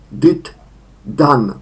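did, done, with done said with a short A; English verb forms. Shown as a demonstration. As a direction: model did and done for students. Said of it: "trzecia forma krótkie a". (did, done z krótkim a)

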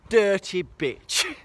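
slow, drawn-out mock insult; a slow teasing jab. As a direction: drag it out playfully.

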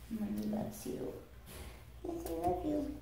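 soft loving coo, rising high. Real, not acted. Comforting pet monkey.

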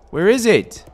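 high, loud where is it; frantic search; panicked. Real, not acted. Rising panic.

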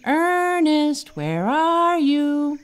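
high calling shout from the vines. Acted. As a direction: call out loud across the jungle. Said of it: "He swung down shouting".

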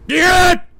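high, loud shout; real shouted repeat. Real, not acted. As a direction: yell it out at the sky.

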